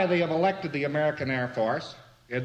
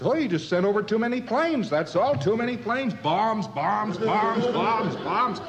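mock German complaint; 6 semitones higher than usual. German answering American visitor.

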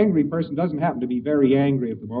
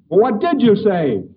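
louder, slower, pressing; an angry man pressing the fearful one for an answer; angry demand; 7 semitones higher, 7 dB louder, slower than usual. Retold or imitated. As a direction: demand it from him. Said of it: "And the fellow said".

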